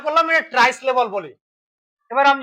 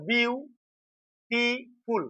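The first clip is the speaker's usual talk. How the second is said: Beautiful slowly and softer, "biu... ti... ful"; three separated, quieter beats on beautiful; split into syllables; 7 dB quieter, much slower than usual. Shown as a demonstration. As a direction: pause after each syllable of beautiful to show its length. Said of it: "ছোট না বড় আছে".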